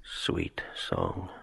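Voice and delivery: whispered song fragment. Acted. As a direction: breathe the words like a memory.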